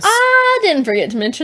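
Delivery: exaggerated indignant denial; mock protest. Real, not acted. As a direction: protest playfully, stretching the opening high. Mock protest at being reminded.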